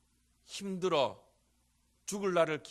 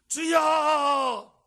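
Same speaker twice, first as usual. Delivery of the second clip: long drawn-out shout, much higher and louder; crying out to God; 12 semitones higher, 12 dB louder, much slower than usual. Shown as a demonstration. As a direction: cry out the word 주여 with everything you have. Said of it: "이거보다 한 열 배는 더 부르짖어야". (길게 끄는 간절한 외침)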